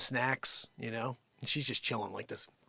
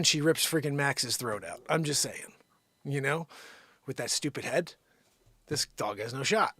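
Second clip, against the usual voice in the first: usual speaking voice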